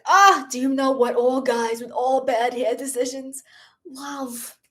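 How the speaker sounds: lightly voiced Boston accent, breathy air behind the words; a playful Boston accent as Paulette; a hint of Boston accent, lots of air; Paulette's Boston accent. Shown as a demonstration. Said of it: "I think of the accent".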